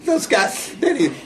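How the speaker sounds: mocking Blassie's near-tearful high-pitched complaint; mock teary whine. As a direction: whimper it like a wounded old manager. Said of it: "he's almost like in tears".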